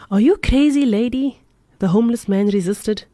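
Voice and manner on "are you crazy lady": loud high incredulous outburst; resisting. A narrator's reading. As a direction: throw it at her in disbelief. Jack resisting the woman.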